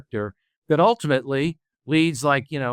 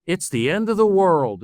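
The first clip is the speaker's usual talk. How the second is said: mocking; 6 semitones higher, 4 dB louder than usual. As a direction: exaggerate the catastrophe to tease the kids. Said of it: "in this very mocking kind of tone".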